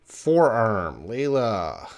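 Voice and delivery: usual speaking voice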